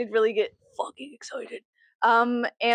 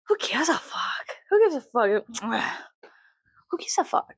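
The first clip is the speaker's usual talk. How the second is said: whispered shrug; 3 semitones higher than usual. Acted. To the viewers about her own feelings, a dismissive whisper. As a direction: breathe it out like you don't care.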